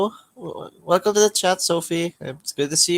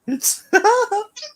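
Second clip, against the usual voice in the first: fake laugh; 5 dB louder than usual. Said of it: "or are you just playing fake?".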